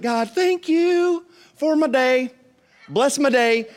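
high, slow, thin mock prayer; lazy little prayer in a put-on high voice; whiny praying believer. Pastor mocking his church's small prayers, high and drawn out. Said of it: "we're just like".

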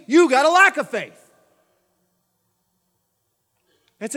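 loud, driving preacher's voice; full-force preaching. Acted. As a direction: preach it hard to the congregation.

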